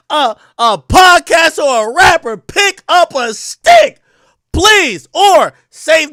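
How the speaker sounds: slow, loud, high shouting, every word hammered; fed-up rant. Real, not acted. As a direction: yell at the tweeting rappers to fight for real. Ranting at rappers who tweet.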